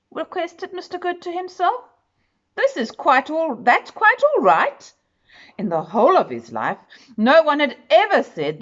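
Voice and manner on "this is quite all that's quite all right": taste the phrase like a happy discovery; delighted repeat. A narrator's reading. Mister Good, overjoyed, repeats the kind words in a bright higher voice.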